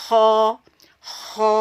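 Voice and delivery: ho said thick with open mouth, loud; Arabic ho, mouth open. Shown as a demonstration. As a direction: open the mouth and push a full ho. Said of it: "Ini seperti ini terbuka".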